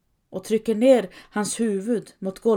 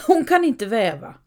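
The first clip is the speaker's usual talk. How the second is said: loud, higher, scornful cry; shouted jeer; 4 semitones higher, 8 dB louder than usual. A narrator's reading. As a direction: shout it out as a taunt. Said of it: "han skriker av skratt".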